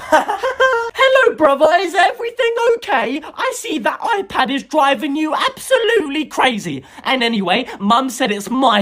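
put-on high, taunting kid sister; little sister voice. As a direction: tease him in a high girly voice. Little sister taunts her brother.

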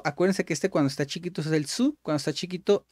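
ordinary talk, nothing marked

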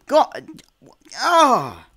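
high, loud cry; frustrated yell. Real, not acted. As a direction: howl at the loss. Dies in game.

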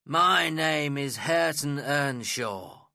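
put-on the young man growls his name at the guest; growled. A narrator's reading. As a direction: grind out the name resentfully. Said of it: "growled the young man".

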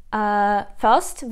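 ordinary delivery

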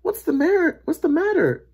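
high, loud urgent question; concerned boy. A narrator's reading. Boy asks screaming lady.

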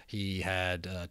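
ordinary delivery

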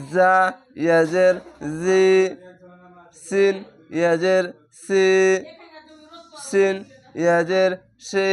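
slow, sung-out spelling of each letter with its vowel; letter drill. Shown as a demonstration. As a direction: chant each letter and its kasra in steady rhythm. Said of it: "এরকমভাবে হবে".

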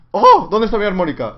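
loud, high yelp of alarm; sudden yelp. Real, not acted. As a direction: blurt it out in a sudden high cry.

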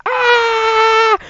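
short high-pitched yelp; high character yell. Pretend. A falling figure yelps high.

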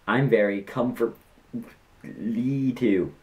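slow, put-on silly voice. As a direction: drag it out in a goofy voice. Reading broken English.

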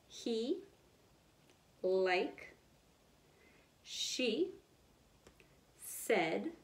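each word alone, slow with long pauses; reading word by word. Shown as a demonstration. Teacher reading sight words slowly with the class. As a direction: read the word wall one word at a time for kids to echo. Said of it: "so we can read it together".

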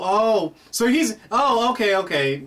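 normal talking voice

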